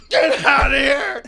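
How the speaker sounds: high, loud laughing shout; panicked shout. Retold or imitated. Shouting at the fiance to get out, high and loud. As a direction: yell her out of the room. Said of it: "I'm like".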